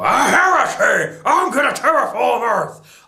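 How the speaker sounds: hammed-up Zod. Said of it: "I sound like a redneck".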